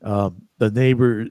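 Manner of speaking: usual speaking voice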